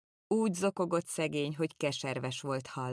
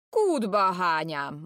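defiant retort; 3 semitones higher, 7 dB louder, slower than usual. Retold or imitated. Pipitér retells refusing to hand over the keys, slow defiant retort. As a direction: throw it back at him, stubbornly. Servant defies the courtier.

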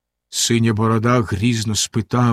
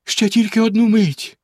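much higher, begging tone; imploring; 9 semitones higher than usual. A narrator's reading. Wife implores Bluebeard, high and pleading. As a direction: implore him for a moment. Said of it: "благально мовила дружина".

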